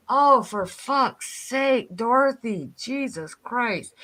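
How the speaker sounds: slow, drawn-out exasperated groan of words. Retold or imitated. Fed-up viewer reacting to Dorothy's endless complaint, exasperated. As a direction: stretch it out, utterly fed up.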